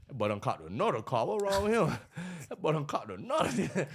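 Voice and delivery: loud, laughing mock amazement; hyped onlooker. Haters seeing he caught another car, hyped mock amazement. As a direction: holler it like an astonished onlooker.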